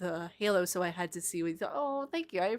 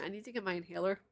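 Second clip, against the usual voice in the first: ordinary talk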